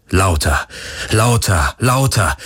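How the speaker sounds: rising intensity on each lauter; building repetition. A narrator's reading. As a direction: let lauter, lauter, lauter climb step by step. Heartbeat growing louder.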